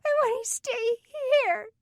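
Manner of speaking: put-on a small girl pleading through tears; crying little girl. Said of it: "Sarah started to cry".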